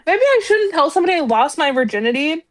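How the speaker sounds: hammed-up thinking it over with hmms; mock pondering. Mocking a game character's flirt, sing-song hmm. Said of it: "be like".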